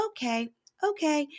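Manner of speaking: high, small mock agreement; placating wife. Wife agreeing with irritated husband, mock placating. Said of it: "And she goes".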